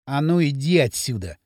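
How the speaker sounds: low, understated surly order; sullen warning. A narrator's reading. Кирилл orders the drunk away, low and sullen. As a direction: send him away with restrained hostility. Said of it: "набычился Кирилл".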